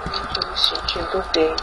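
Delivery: soft, low, sickly moan; feeling sick.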